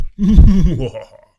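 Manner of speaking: high, loud villain cackle; evil cackle. Acted. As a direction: cackle like a wicked witch. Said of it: "My evil laugh sounds like this".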